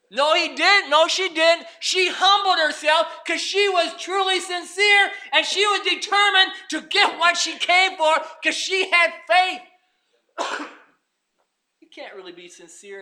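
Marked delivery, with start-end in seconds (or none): 0.1-9.6 loud, high preacher's shout; full-force preaching. Acted. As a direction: hammer the point home.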